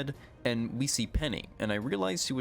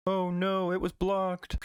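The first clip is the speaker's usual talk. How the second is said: higher, hammed-up cartoon whine; mock dismay; 9 semitones higher, slower than usual. Pokemon whining that its attack got blocked, higher mock dismay. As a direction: whine like a defeated cartoon monster.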